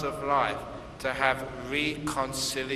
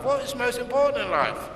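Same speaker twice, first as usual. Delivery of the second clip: high, quick rhetorical question; rising rhetorical question; 10 semitones higher, faster than usual. Acted. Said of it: "rhetorical question to audience".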